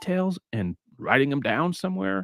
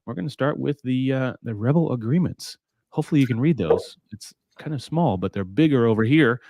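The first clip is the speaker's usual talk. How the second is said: usual speaking voice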